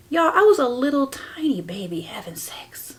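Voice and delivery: airy whisper, confiding; whispered confession. Real, not acted. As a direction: whisper it as a shocked aside. Confiding shocking fact.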